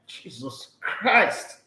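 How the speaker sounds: slow, drawn-out Jesus Christ with a falling end; slow despairing Jesus Christ; exasperated groan. Real, not acted. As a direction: say it again in weary disbelief, audible this time. Repeats in exasperation.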